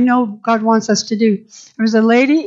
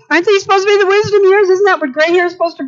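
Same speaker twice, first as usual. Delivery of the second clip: indignant rhetorical question; 6 semitones higher, much faster than usual. Real, not acted. Preacher challenging congregation, excited indignant question. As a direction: push the question at them, incredulous.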